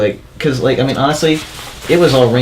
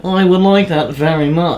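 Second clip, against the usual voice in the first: put-on slow, higher-pitched British accent; mock British accent; 4 semitones higher, slower than usual. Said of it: "Did we do accents".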